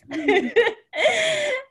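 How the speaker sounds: squealing high laugh. Real, not acted. Big laugh at bite answer.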